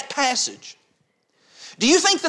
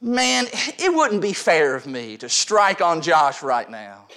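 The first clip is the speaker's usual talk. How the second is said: mock devil; 7 semitones lower, slower than usual. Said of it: "the devil looks at me in that moment and says".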